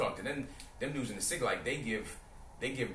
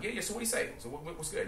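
chest-out confidence; 7 semitones higher than usual. Retold or imitated. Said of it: "talking with my chest out".

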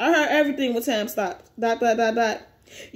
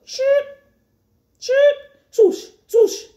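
high, loud whooshing effect noises; sound effects; 12 semitones higher, 5 dB louder, much slower than usual. Acted. Reading the teleport panels, high, loud whooshes. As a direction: belt out each whoosh like a cartoon.

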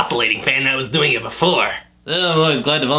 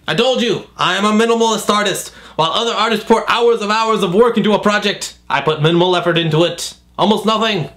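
raised, huffy self-defense; indignant artist; 5 semitones higher than usual. Acted. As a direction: insist you're a serious artist. Defends blank picture.

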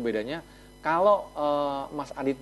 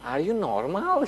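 slow, higher, puzzled mock question; John's thoughts, slow and a little higher, puzzled; incredulous John; 5 semitones higher, slower than usual. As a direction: play John baffled by the invitation. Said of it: "Si John mikir".

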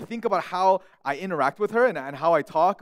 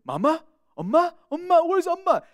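put-on high, louder toddler wail for mama; toddler calling for mom; 11 semitones higher, 5 dB louder than usual. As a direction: call out like a lost little girl. Toddler crying for her mom.

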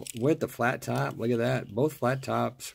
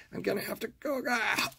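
deteriorating voice; 11 semitones higher, 3 dB quieter, faster than usual. Real, not acted. Collector whose voice is deteriorating, high and failing. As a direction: voice climbing into a high strained croak. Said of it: "My voice is deteriorating even further".

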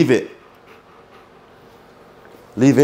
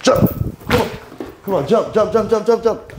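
eager urging; 10 semitones higher than usual. Real, not acted. Urging the dog to jump.

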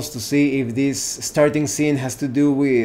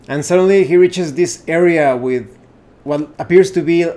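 ordinary talk, nothing marked

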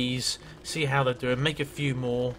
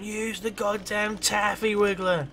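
imitating a frantic viewer, higher and slowed; mock viewer yell; 7 semitones higher, slower than usual. Imagined viewers shouting advice at him, a mock high yell. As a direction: play an exasperated commenter yelling advice. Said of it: "people were probably screaming and going".

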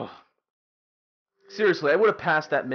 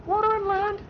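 "Water and land" repeated in a much higher mocking falsetto; "Water and land" echoed as a much higher silly squeak; squeaky mock echo. Mocking echo of his complaint.

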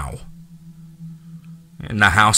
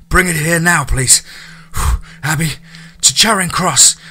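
urgent, breathless, louder voice; panting urgently; 8 semitones higher, 4 dB louder than usual. A narrator's reading. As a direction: push it out between breaths. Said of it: "Charlie panted".

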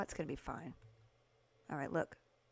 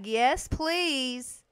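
ordinary talk

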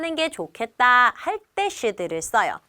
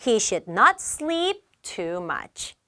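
You should save money spoken very slowly, word by word; slow teacher read-aloud; slow model sentence; 3 semitones lower, much slower than usual. Shown as a demonstration. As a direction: read it slowly for learners to copy. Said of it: "model sentence".

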